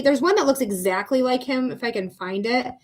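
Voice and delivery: usual speaking voice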